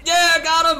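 high, loud, urgent cheering on; excited. Real, not acted. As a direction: hype shouts, go go go. Last missile, tense kill.

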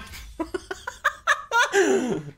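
high, long burst of laughter; laughing. Real, not acted. Streamer cracking up after shouting game time, high long laughter. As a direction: crack up, starting high and airy, falling at the end.

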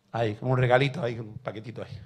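low, quiet, knowing; sly hushed aside. Retold or imitated. Preacher hinting at the hidden knife, low and quiet. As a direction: murmur it like a dark secret.

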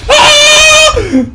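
high, loud scream; long high yelp; startled scream. Real, not acted. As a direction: scream out in alarm, voice leaping up. Sudden danger in game.

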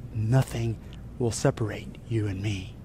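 low, softening, intimate promise; hushed assurance. Acted. Jesus promises the believer they will never be parted, hushed assurance. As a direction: promise it quietly, voice dropping away. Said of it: "He whispers into your ear".

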